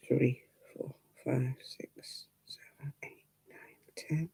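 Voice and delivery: counting under her breath in a whisper; a whisper while counting stitches. Real, not acted. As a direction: drop to a whisper as you count. Counting knitting holes under breath.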